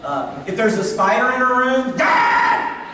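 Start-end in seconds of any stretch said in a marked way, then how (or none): 2.0-2.8 short, sudden mock shriek for Dad; imitated scream. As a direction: imitate a scared kid yelling for Dad. Daughter screaming at a spider.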